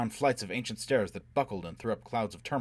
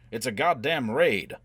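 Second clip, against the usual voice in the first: slow, loud, raised-pitch shout; urgent alarm; 4 semitones higher, 7 dB louder, slower than usual. A narrator's reading. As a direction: shout it out, stretching the words. Alarm during the raid.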